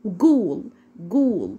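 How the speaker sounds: "جول" starting with hard g, long oo. Shown as a demonstration. Teacher demonstrates ghoul for learners, "جول" with hard g. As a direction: model ghoul as "جول", g not ghain. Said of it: "نطقناها في أول الكلمة زي".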